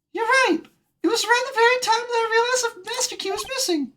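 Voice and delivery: very high, squeaky cartoon voice; excited witness character. Witness realizing when the Master Key went missing, high excitement.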